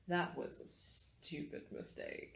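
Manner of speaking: slow, whispered threat; hushed, deliberate villain voice; menacing villain. Acted. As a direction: whisper it slowly, as a cold warning. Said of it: "he looks at you and he says".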